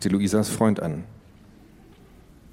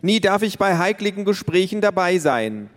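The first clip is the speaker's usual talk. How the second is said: put-on whiny child's call; 10 semitones higher than usual. A narrator's reading. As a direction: cry out that it's never fair, much higher. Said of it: "rief Tiffany".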